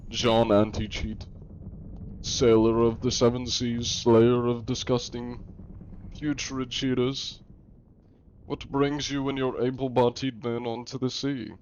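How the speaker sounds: put-on pompous old-time proclamation, borderline German accent; John Anti-Cheat. Inspector announcing himself to a crew, grand mock-archaic. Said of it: "borderline doing the German accent when I talk".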